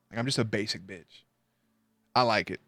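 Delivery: ordinary delivery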